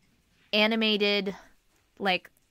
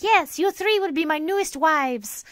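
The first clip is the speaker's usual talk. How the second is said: sheik voice; 9 semitones higher than usual. Retold or imitated. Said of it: "but he's still like".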